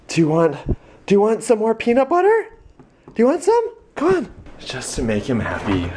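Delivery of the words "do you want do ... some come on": high, quick coaxing, rising at the questions; excited pet talk. Real, not acted. Owner offers the dog peanut butter, excitedly coaxing. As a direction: tempt a dog with a treat in a high voice.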